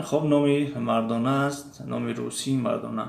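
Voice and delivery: usual speaking voice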